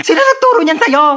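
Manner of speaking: very high scandalised cry; shocked outrage. Acted. Mother hearing the toddler swear, very high shocked cry. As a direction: shriek in disbelief.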